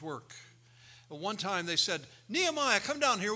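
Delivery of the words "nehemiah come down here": high, loud call up to him. Acted. Enemies calling up to Nehemiah, loud and high. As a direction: shout up the hill to get his attention.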